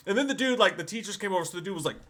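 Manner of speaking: ordinary talk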